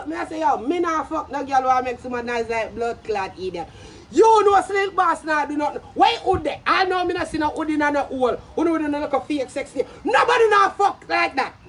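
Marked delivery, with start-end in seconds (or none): none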